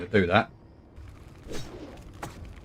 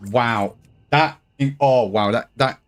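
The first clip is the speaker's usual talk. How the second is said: loud, stunned wow; amazed; 3 semitones higher, 5 dB louder, slower than usual. Real, not acted. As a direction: blown away, stammering. Amazed at mana regen.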